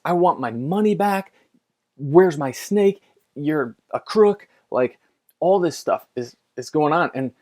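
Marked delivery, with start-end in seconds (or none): 0.0-4.5 raised, louder imitation of a furious buyer; mock furious buyer. Retelling the buyer who wants his money back, mock angry. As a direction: bark it like the angry customer. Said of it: "he's furious".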